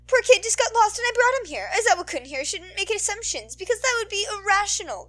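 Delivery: high, loud teasing chatter; bubbly heroine voice. Acted. Midnight teasing Aizawa, high and bubbly. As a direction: tease him in front of the kid.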